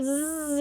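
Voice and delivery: short soft buzz on zzz; a quick quiet buzzing zzz; buzzed z sound. Shown as a demonstration. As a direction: hum zzz through the teeth. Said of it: "c'est pour ça qu'il fait zzz".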